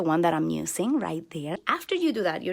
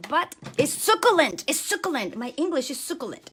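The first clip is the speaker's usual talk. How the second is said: playful self-mockery; 6 semitones higher than usual. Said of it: "my English is not that marvelous".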